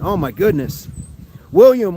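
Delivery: usual speaking voice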